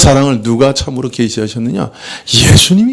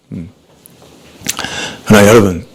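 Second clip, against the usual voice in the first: ordinary talk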